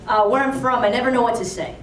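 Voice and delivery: usual speaking voice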